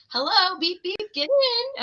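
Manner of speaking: high, slow playful call; sing-song mock greeting. Mom offers wrestler a ride, high sing-song. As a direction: chirp it like a cheery driver. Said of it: "I'm like".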